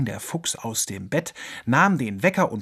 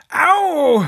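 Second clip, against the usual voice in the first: sharp, much higher, loud yelp; yelp of pain; 14 semitones higher, 9 dB louder than usual. Acted. Fox banging his head on the cupboard, yelping. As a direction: howl out the sudden pain. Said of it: "heulte der Fuchs auf".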